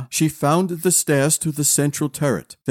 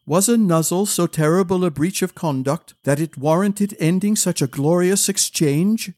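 ordinary delivery, nothing marked

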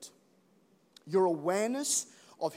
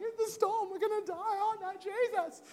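much higher, hammed-up fear; mock panic. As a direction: play the panicked disciple. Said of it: "the person in the boat going".

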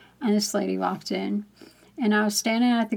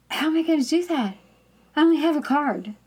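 higher, quick objection; protesting; 6 semitones higher than usual. Retold or imitated. Customer objecting to God's request at checkout, protesting. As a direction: argue back in mild exasperation.

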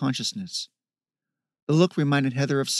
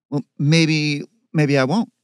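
raised, defiant sister; 3 semitones higher, 7 dB louder than usual. Acted. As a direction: push back hard, louder. Sister quarrels with brother.

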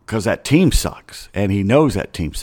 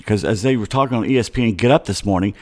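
ordinary delivery

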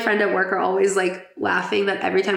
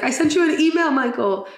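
Toto doll catchphrase; 6 semitones higher than usual. Retold or imitated. Toto's talking doll scolding Michael, mock stern. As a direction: deliver it like a pull-string toy. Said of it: "Or then it's like".

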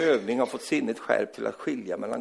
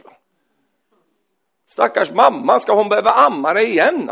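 raised, higher preaching voice with chuckles; fiery preaching; 9 semitones higher, 8 dB louder than usual. Acted. Preacher scolding grown believers who stay childish, fiery preaching. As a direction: drive it home to the congregation.

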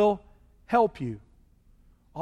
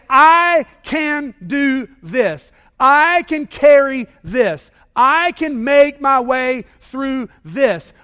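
each I high, loud and stretched; very slow mock self-reliance, hammering every I; stress on I; 4 semitones higher, 7 dB louder, much slower than usual. Voices people stepping into God's place.

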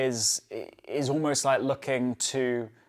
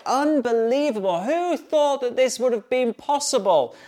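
higher-pitched gushing passenger voice, hammed-up; mock amazement; 5 semitones higher than usual. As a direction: play a passenger gushing over internet in the sky. Amazed passengers on wifi flight.

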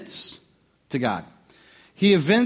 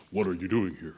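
a deep, soft, booming-style voice of God, much lower; James Earl Jones voice; 10 semitones lower, 8 dB quieter than usual. Pretend. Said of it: "sounds like James Earl Jones".

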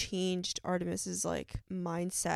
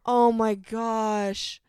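long drawn-out loud oh my gosh; overwhelmed; 8 dB louder, much slower than usual. Real, not acted. Reviewer reliving the scene, loud and drawn-out. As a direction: stretch it out, still reeling.

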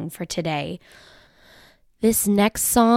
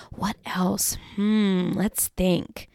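hushed wondering; conspiratorial whisper; whispered thinking; 6 dB quieter, much slower than usual. Pretend. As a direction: whisper it like a secret. Pondering aloud to kids.

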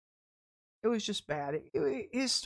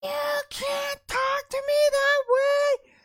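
high, loud mock scream; retold scream; 9 dB louder, slower than usual. Young man screaming at [name] in the deli, loud and high. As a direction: shriek it back like the angry young man. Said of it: "he starts screaming at me".

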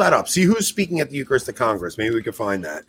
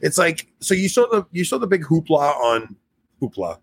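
ordinary delivery

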